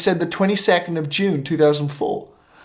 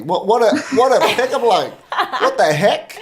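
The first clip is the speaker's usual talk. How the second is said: laughing at himself; 6 semitones higher than usual. Real, not acted. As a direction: laugh at your own clumsy line. Laughing at own pickup line.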